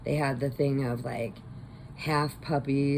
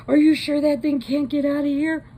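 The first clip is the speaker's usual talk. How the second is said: nervous question; 11 semitones higher, 7 dB louder than usual. Retold or imitated. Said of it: "And he goes".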